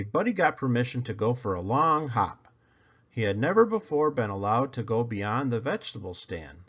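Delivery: plain reading voice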